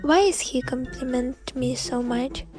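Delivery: ordinary delivery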